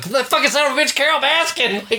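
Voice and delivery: high-pitched mock fury; retold yell. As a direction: curse her name way up high. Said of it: "I'm yelling at Carol Baskin".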